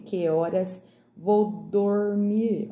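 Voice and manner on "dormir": "dormir" stretched very slowly, syllable by syllable; drawn-out D word. Shown as a demonstration. Teacher making pupils hear the D, "dormir" slow and stretched. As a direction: sound out "dormir" with a long "dor". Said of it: "Olha o som".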